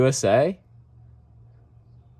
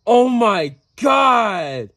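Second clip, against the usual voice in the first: slow, louder excited oh my god; drawn-out excited cry; 5 semitones higher, 4 dB louder, much slower than usual. Real, not acted. Thrilled at the extended version, slow excited exclamation. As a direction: stretch it out in delight.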